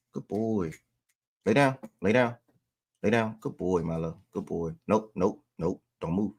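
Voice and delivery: calm him down quietly; gentle murmur to keep the dog still; soft dog praise. Real, not acted.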